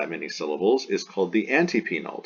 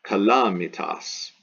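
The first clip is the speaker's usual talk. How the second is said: "Calamitas" slowly, weight on LA; slow demonstration of "Calamitas" stressed on LA; slower than usual. Shown as a demonstration. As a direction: lean on the second syllable, ca-LA-mi-tas, in "Calamitas". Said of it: "the accent falls here on the A".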